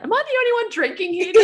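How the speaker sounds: very high, fast mock-indignant squeak; tipsy friend. Anna with champagne, a high fast mock-indignant squeak. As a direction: play the friend left drinking alone.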